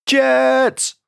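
high-pitched, urgent scream. A narrator's reading. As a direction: scream it out high, one desperate word. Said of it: "I screamed".

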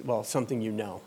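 ordinary delivery

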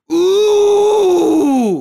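long, high, loud "ooh"; high mock moan. Man climaxing in the acted bed scene, high mock moan. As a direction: hold a hammed-up high whoop. Man's noise in the bed skit.

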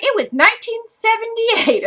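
playfully high, put-on voice; mock announcement. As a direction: put on a high voice for an imagined discovery. Acting out her future self's discovery.

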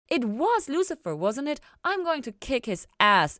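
high, loud mock threat; fiercely protective. A narrator's reading. Ella vowing to kick Lucifer's ass, loud and high. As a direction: declare you'll deal with him. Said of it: "Ella decided".

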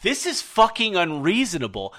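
moderately loud retold outburst; retold yelling. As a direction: re-enact your exasperated yelling. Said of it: "I start yelling at the two of you".

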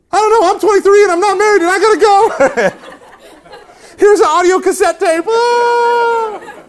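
high, loud, slow panicked wail; hammed-up squeal, way up in pitch; mock panic. Flustered young lecturer to crying wife, comic panic.

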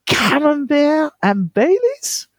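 put-on high, slow, outraged French accent; a French lover appalled at Camembert and Baileys, in a high exaggerated French accent; mock French accent. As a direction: scold him in a hammed-up French accent, pitched high and drawn out. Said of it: "in a lovely French accent".

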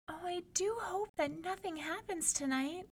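high, soft, sighing worry; a soft sighed fear, pitched high. A narrator's reading. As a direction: sigh it out as the timid sister. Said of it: "the youngest one sighed".

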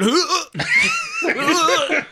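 loud, high gagging heave; imitated retch. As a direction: mimic gagging mid-conversation. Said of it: "he's just bullshitting, and then".

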